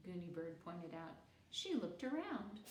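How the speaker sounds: plain reading voice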